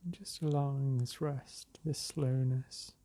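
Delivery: unhurried meditation voice with long pauses; guided meditation. Real, not acted. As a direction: ease them into the breath, drawing words out. Leads a breathing meditation.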